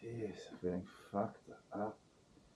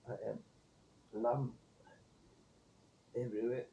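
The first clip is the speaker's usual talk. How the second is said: ordinary talk, nothing marked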